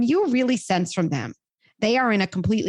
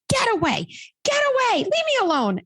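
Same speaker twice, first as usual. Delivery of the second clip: put-on a teen shrieking at parents to go away; upset teen. Teen yelling at her parents.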